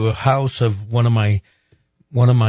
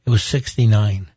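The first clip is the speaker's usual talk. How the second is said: ordinary delivery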